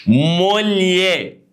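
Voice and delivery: slow model word; Italian word demo. Shown as a demonstration. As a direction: stretch "موليه" like an Italian teacher. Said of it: "دي تتنطق ايه دي بقى؟".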